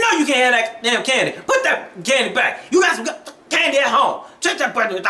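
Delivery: a hint of a harsh mother; cussing mom. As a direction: mimic a mom cussing at her kid. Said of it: "Just cussing and going on".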